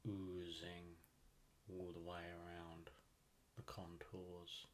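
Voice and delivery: soft, unhurried meditation voice with long pauses; calm, even delivery, leaving space between phrases; guided meditation. Acted. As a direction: guide the listener gently into deep relaxation.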